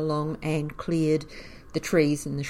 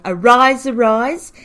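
loud, raised town crier call; town crier's call; 6 semitones higher, 11 dB louder than usual. Retold or imitated. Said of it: "ringing his bell very loudly and saying".

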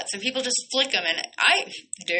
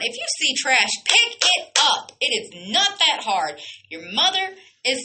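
louder and higher, slowing on pick it up; heated outburst; 5 semitones higher, 4 dB louder than usual. Real, not acted. Radio host scolding litterers, heated loud outburst. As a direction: scold the listeners with real heat. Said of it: "I'm getting pretty heated up about this".

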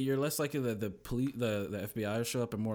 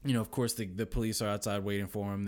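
ordinary talk, nothing marked

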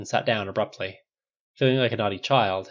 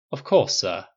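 AI butler voice; 2 semitones lower, 5 dB louder, slower than usual. Acted. Jarvis AI voice.

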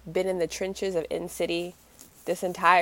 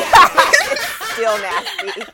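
high, slow, laugh-filled drawl; 12 semitones higher, 11 dB louder, much slower than usual. Real, not acted. Friend laughing along at the fandom name. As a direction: laugh through the words.

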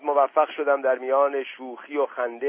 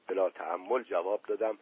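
usual speaking voice

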